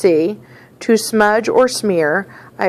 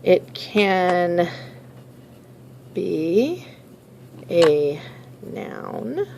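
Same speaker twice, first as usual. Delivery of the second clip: word by word, long pauses, quieter; slow dictation; 3 semitones lower, 7 dB quieter, much slower than usual. Shown as a demonstration. Teacher to class, dictating slowly while writing on paper. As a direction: spell it out slowly as you write it down. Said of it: "So on my paper, I'm going to put".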